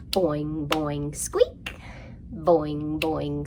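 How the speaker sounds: ordinary talk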